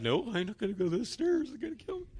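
lightly voiced frightened dog, pitch shooting up; scared dog. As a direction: play the balking dog, mildly panicky. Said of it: "the dog's just sitting there like".

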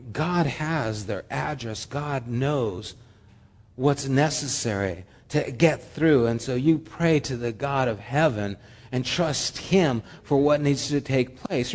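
ordinary talk

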